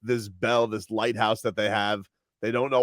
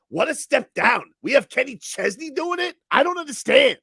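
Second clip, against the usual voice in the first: much higher, whiny mock-outrage fan voice; mocking Boston fans. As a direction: play a disappointed fan, hammed up. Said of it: "And then they're like".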